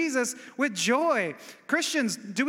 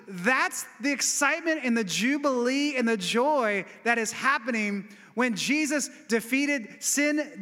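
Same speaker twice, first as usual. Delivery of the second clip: normal talking voice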